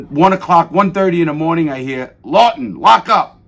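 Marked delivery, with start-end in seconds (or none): 2.2-3.4 guard calling out. Retold or imitated. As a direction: bark it through the cell door like a guard. Guard calling out at cell door.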